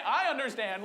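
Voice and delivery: ordinary delivery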